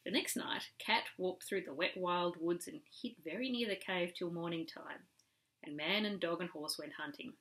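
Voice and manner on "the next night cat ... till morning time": plain reading voice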